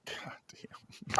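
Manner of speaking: whispered curse, barely voiced; very soft, voiceless exasperation; hushed mock dismay. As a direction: let it out under your breath. Weary Gotham resident reacting.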